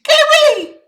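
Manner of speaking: very high, loud screech; monkey screech. A narrator's reading. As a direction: shriek like a startled monkey. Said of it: "began screeching".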